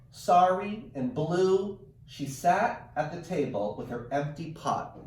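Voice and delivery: lively read-aloud storytelling; animated picture-book narration; reading to children. A narrator's reading. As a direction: read it like story time for kids. Teacher reads picture book aloud.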